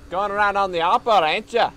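loud, high mock Australian accent. Shown as a demonstration. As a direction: belt out a horrible Aussie accent. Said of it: "horrible, like, stereotypical".

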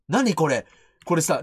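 normal talking voice

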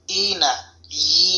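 slow, careful ই sounds; teacher modelling ই; letter ya demo. Shown as a demonstration. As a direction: press the tongue to the palate and say ই slowly. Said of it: "জিহ্বার মধ্যখান উপরে তালুর সাথে লাগিয়ে".

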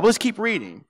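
usual speaking voice

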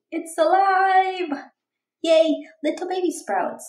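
joyful sung exclamation; thrilled shout. Acted. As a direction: sing out the discovery. Child sees sprouts appear.

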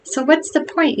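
understated yell; mild impatient yell. A narrator's reading. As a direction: demand it like an exasperated boy, without real volume. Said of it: "yelled Billy".

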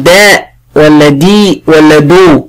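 "دا", "دي", "دو" each drawn out with a distinct vowel; contrasting short vowels. Shown as a demonstration. Teacher asks which vowel rounds the mouth, contrasting "دا", "دي", "دو". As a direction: contrast open "دا", spread "دي", rounded "دو". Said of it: "ضمت، ... ضمت فمي".

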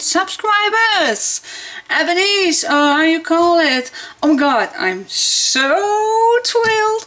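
high thrilled squeals; shrieking jackpot winner. Real, not acted. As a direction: gush with real excitement. Excited subscriber milestone.

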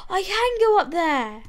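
high, loud burst after a gasp; excited realisation. Real, not acted. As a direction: exclaim it as the idea lands. Gasps at sudden realisation.

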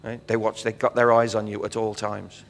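ordinary talk, nothing marked

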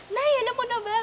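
high, loud eager question; excited question. Retold or imitated. Telling mother on the phone, high and excited. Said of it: "Sabi ko".

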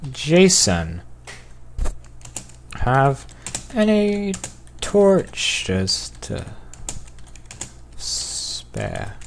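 drawn-out words with long pauses; slow dictation. Real, not acted. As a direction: sound it out word by word as you type. Slowly sounding out typed message.

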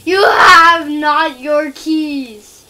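loud, very slow, drawn-out shout; mock proclamation. Player taunting the groundskeeper over keys, in a mock shout. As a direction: bellow it word by word, stretched out.

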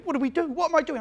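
put-on a scared boy asking what to do; panicky kid. New kid panicking on his first morning, flustered. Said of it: "He's going".

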